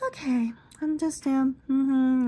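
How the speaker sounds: high, clipped agreeable murmur; lightly voiced salon lady; beautician voice. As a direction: play the beautician nodding along. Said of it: "And she's like".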